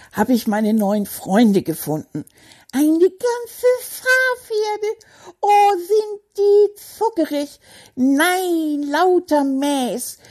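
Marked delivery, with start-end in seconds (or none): 2.7-10.2 gushing delight. Acted. Delighted by the sheep herd.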